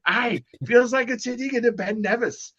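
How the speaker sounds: high-pitched put-on Scottish brogue; Scottish accent. Golf host voicing a Scot naming the hole, in a high Scottish accent. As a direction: play an old Scot joking about the hill, in a Scottish accent. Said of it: "this is me doing my Scottish accent".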